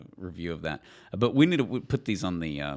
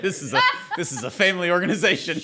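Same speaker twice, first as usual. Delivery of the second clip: laughing, quick and raised, hamming up the piety; mock family-friendly; 7 semitones higher, 5 dB louder, faster than usual. Host teasing the cohost about beer, laughing mock prim.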